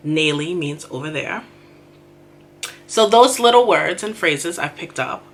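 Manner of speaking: ordinary delivery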